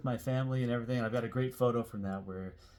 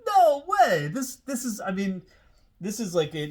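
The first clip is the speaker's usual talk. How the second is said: much higher and louder, bursting with disbelief; amazed exclamation; 9 semitones higher, 8 dB louder than usual. Real, not acted. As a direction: blurt it out, blown away. Amazed at finding the original shirt.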